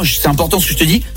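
usual speaking voice

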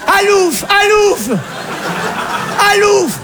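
high shrieks to scare someone off; piercing cries, far above normal pitch; Alsatian mother. Acted. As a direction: shoo an intruder in a shrill mother-in-law voice. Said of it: "la mère alsacienne arrive".

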